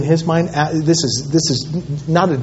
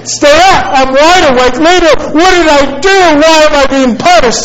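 high-pitched imitation of a child's indignant pleading; devastated boy; 9 semitones higher, 3 dB louder than usual. Son asks dad why he is being punished, mock devastated. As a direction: protest each phrase like a wronged little boy. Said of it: "he's emotional, and there's tears in his eyes".

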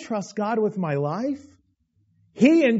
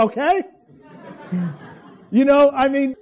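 laughing; 6 semitones higher, 5 dB louder than usual. Real, not acted. Preacher laughs at himself.

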